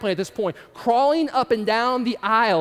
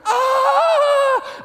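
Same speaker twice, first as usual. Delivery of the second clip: high, loud panicked scream; shrill comic panic yell; Home Alone scream; 7 dB louder than usual. Pretend. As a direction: let out a hands-on-cheeks Home Alone shriek. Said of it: "one of those Home Alone moments".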